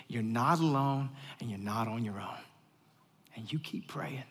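hushed whisper of comfort. Real, not acted. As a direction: breathe it to them in a whisper. Whispered reassurance to congregation.

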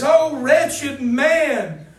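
loud and very slow anguished lament; Paul crying out in anguish, loud and slow. Acted. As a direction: cry it out like a man in despair. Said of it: "he gets so upset".

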